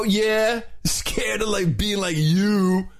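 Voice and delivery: high, stretched-out surfer dude. Shown as a demonstration. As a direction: drawl it like a surfer. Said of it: "the whole thing in a surfer's voice".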